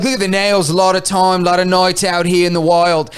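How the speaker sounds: mock Australian accent; Steve Irwin. Crocodile hunter character examining the catch, Australian accent impression. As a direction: wildlife presenter commentary, put-on Aussie. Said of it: "an Australian accent".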